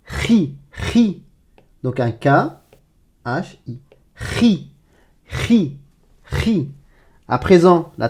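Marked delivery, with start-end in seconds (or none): 0.1-1.2 "ri" said khi, with a scraped throaty onset; syllable demo. Shown as a demonstration. Teacher gives the second syllable, "ri" as guttural khi. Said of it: "ça me donne la syllabe". 4.1-6.7 "Ri" as khi, higher and soft; syllable drill. Shown as a demonstration. Teacher drills the syllable for learners, "Ri" as guttural khi. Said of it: "ça me donne la syllabe".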